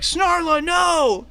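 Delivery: high, loud melodramatic wail; crying out. Acted. Companion cries out to his grappled princess, anguished shout. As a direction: cry out like a hammy hero. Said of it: "he cries out and he's like".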